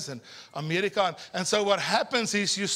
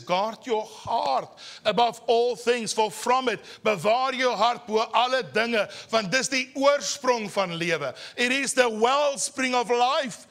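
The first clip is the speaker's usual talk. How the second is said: scripture recited; 4 semitones higher, 3 dB louder, slower than usual. Acted. Reads Proverbs verse in preaching voice.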